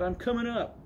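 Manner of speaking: high-pitched mock boy yell. A narrator's reading. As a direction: announce it defiantly, pitched high. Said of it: "yelled Tad".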